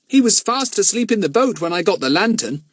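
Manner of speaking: put-on a puzzled boy recounting; boy telling Bill about Andros; boy's voice. Jack explains.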